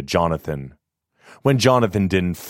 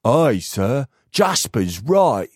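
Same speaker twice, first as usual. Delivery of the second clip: higher, country workman agreeing; a hint of a rustic laborer; rustic gravedigger; 5 semitones higher, slower than usual.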